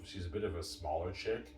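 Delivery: ordinary talk, nothing marked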